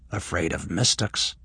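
ordinary delivery, nothing marked

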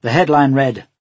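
plain reading voice

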